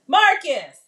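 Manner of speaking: loud yell of his name. Real, not acted. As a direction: holler for him across the house. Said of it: "this is why I mute".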